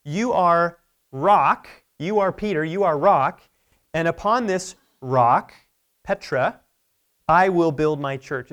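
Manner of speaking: "rock" hit loud and drawn out each time; slow, loud declaration, landing hard on each "rock"; stressed wordplay. Retold or imitated. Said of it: "Jesus do a little play on words".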